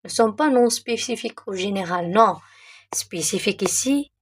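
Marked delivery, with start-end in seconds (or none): 2.9-4.1 whispered aside. Real, not acted. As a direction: whisper the key point to the class. Teacher aside stressing the point. (chuchoté, comme un aparté)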